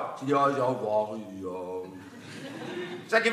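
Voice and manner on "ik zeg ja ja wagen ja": low, slow, mumbling character; a lightly voiced dopey local; mumbled answer. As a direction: drop the voice and mumble it.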